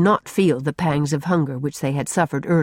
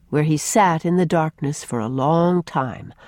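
ordinary delivery, nothing marked

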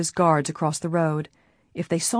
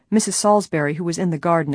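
usual speaking voice

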